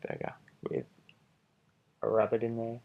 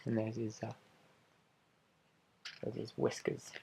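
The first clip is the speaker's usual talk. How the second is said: plain reading voice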